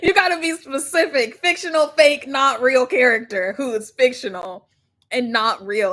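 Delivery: loud, insistent punches on "fictional, fake, not real"; emphatic stress. Real, not acted. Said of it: "I cannot stress it enough".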